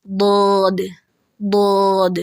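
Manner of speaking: بود said correctly as a full heavy letter; correct heavy sound. Shown as a demonstration. Teacher giving the correct pronunciation. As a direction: land on the proper بود. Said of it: "بلکہ یہ کیا ہے؟".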